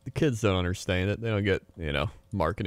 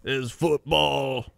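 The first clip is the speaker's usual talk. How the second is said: higher, slow mock British accent; British guy; 5 semitones higher, much slower than usual. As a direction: drawl it as a cartoon British football fan. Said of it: "your British guy".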